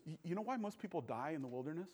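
soft and quick, lightly voiced calm mentor; a hint of unflappable old man, quietly; calm older guy. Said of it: "he's just really calm".

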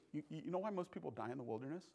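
soft and quick, lightly voiced calm mentor; a hint of unflappable old man, quietly; calm older guy. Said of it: "he's just really calm".

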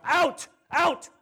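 loud, high command in separate bursts; acted angry bellow; fed-up shout. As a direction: throw the crowd out at full voice. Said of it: "I'm so done with the crowd".

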